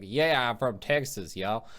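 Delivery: slow, drawn-out Texas drawl; Texas accent. Acted. Former theater actor showing what not to do onstage, exaggerated Texas accent. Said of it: "cleaning out my accent".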